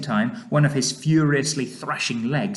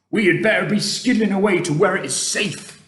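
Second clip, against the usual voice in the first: higher, louder friendly giant voice; urgent giant voice, pitched up and forceful; BFG giant character, urgent; 8 semitones higher, 7 dB louder than usual. Acted. BFG to Sophie about getting to safety, urgent.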